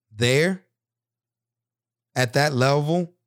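ordinary talk, nothing marked

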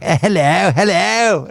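high, drawn-out mock greeting; comic airman catchphrase. Airman in the amateur play greeting the audience, high comic voice. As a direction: ham it up like a sitcom airman popping on stage. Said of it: "coming out in the airmen and going".

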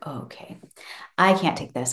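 hushed, whispered to herself; spooked whisper; whispered dread. Retold or imitated. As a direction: whisper it so the child won't hear. Mum spooked at night.

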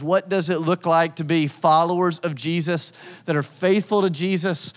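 usual speaking voice

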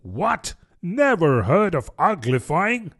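high, incredulous questioning; surprised exclamation. A narrator's reading. Griffin lifts its paws at Alice's ignorance, exclaiming in surprise. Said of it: "in surprise ... it exclaimed".

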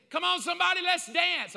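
high, loud rally cry; shouting at the crowd to dance; mock hype call. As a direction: imitate a pushy worship leader.

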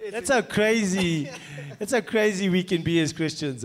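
words bubbling with laughs; laughing. Real, not acted. Pastor laughing while making his point, laughing speech.

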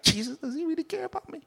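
high, soft, fast anxious question; soft high worried murmur; doubtful whisper. Retold or imitated. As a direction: fret quietly to yourself.

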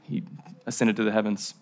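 low-key aside. Shown as a demonstration. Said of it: "Low-key detail".

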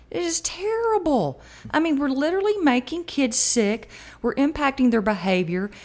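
high, indignant outburst; outraged. Real, not acted. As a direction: rise up in righteous alarm. Outraged about school lunches.

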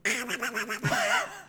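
high nasal cartoon duck quack. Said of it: "That was a very good duck voice".

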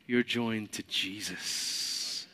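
slow, hushed, intimate. Real, not acted. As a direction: let it sink in very slowly.

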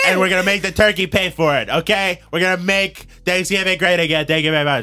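mock rally speech building up; hammed-up campaign promise, then sign off; Donald Trump. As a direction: parody politician driving home the pledge. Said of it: "it's Donald Trump".

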